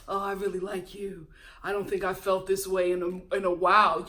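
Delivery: low, mock-sincere suitor; smitten date act. Host mocking a man's sweet talk on a date, low and syrupy. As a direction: lay it on thick in a low voice. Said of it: "all the stuff that he is saying".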